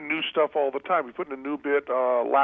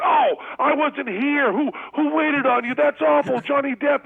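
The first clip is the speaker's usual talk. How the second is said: mock distraught scream; 4 dB louder than usual. Counter girl learning she missed Johnny Depp: high mock wail. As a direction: ham up a fan's dismay at missing a star. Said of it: "she started screaming and crying".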